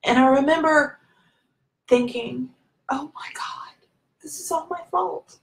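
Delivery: tearful near-whisper, rising in pitch; emotional. Real, not acted. Woman recalling the moment she blamed herself, tearfully emotional. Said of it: "I'm getting so emotional here".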